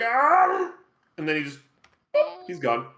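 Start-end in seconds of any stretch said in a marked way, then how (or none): 0.0-0.7 pained cry. Acted. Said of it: "he goes like".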